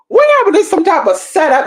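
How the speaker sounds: high, startled squeal of suspicion; alarmed realization. Retold or imitated. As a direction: voice jumps up in panicked disbelief. Sudden paranoid realization, voiced thought.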